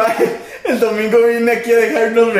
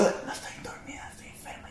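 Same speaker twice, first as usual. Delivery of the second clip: soft voiceless whisper; mock whisper insisting she's not asleep but sick; imitating a whisper; 18 dB quieter than usual. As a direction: whisper it like someone with no voice. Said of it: "Y sigue susurrando".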